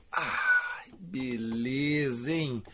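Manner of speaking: after a sip of drink; talking just after drinking; while sipping a drink. Real, not acted. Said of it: "Deixa eu dar um gole aqui".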